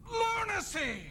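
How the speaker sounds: outraged. Acted. Director rants about the voice.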